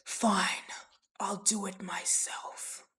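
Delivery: slow whispered resolve; mock-grim whisper. Margaret deciding to step up herself, slow dramatic whisper. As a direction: whisper it slowly, like a dramatic film line. She was basically like.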